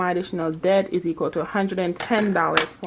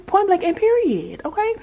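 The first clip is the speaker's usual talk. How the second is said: sassy sign-off; 11 semitones higher than usual. Real, not acted. Emphatic sign-off catchphrase.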